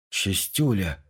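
low, hushed frightened gasp of a name. A narrator's reading. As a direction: breathe it out in dread. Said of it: "испуганно констатировал Сергей". (испуганно, шёпотом)

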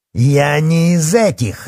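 slow, high, loud furious roar. A narrator's reading. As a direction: bellow each word in outrage. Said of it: "рявкнул он".

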